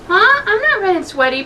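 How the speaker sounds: high flustered lying; squeaky, caught-out denial; nervous denial. Acted. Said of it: "Pepper lied".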